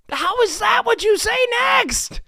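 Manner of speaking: high, loud exasperated ranting; incredulous rant. Real, not acted. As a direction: keep ranting in disbelief. Still ranting about remark.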